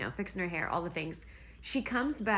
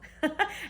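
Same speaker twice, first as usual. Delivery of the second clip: giggle; 8 semitones higher than usual. Real, not acted. Laughs at the "MIT girl" nickname.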